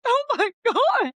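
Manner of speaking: high, slow, hammed-up "oh my god"; playful overdone horror at skipping meals; mock horror. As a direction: squeal it in mock dismay. Retold dismay, laughing.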